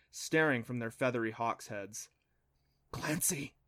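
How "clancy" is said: urgent hushed whisper; softly hissed warning. A narrator's reading. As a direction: whisper the name to get her attention. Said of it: "Adam whispered".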